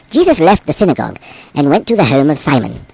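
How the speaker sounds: ordinary delivery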